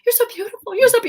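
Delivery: imitating a gushing mom, rising high and loud. Daughter mimicking mom's doting praise, hammed-up gush. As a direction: rave with over-the-top adoration. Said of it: "she'd be like".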